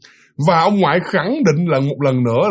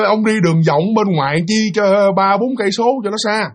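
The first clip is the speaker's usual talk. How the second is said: higher-pitched, exclaiming local; teasing drinking buddy; 6 semitones higher than usual. Acted. Drinkers tell the country newcomer about a shortcut, teasing and higher.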